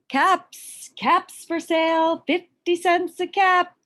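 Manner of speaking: high, loud street cry; calling out wares. A narrator's reading. Peddler calling to the street, selling caps, loud sing-song cry. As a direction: sing out the price to passers-by. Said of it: "he called".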